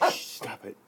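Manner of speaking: urgent hushed scolding; whisper yelling. Real, not acted. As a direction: hiss it without voice. Hushing dog near stunned bird.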